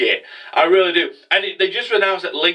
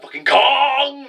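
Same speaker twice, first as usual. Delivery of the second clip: high, drawn-out exclamation; mock battle cry; 10 semitones higher, much slower than usual. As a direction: stretch it out like a hammed-up cry. Acting out character's outburst on rising.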